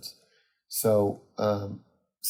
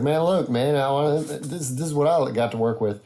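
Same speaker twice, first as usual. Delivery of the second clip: higher, louder country frat-guy comic, badly imitated; terrible Parker; 4 semitones higher, 3 dB louder than usual. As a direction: do a rough impression of a drawling stand-up. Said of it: "it's a terrible Parker impression".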